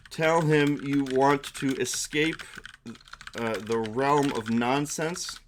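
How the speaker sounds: normal talking voice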